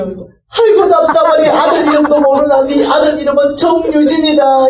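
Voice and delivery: high, sighing exasperation; exasperated old mother. Retold or imitated. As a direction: scold a child who forgot. Mother frustrated, thinks daughter confused. (답답해하며 높은 톤으로 타박하듯)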